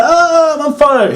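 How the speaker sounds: high mock yell of a man on fire; mock Kane yelling. Wrestler mocked by vlogger, hammed-up high yelp. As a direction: ham up the burning wrestler's cry. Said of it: "He's like".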